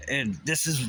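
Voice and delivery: ordinary talk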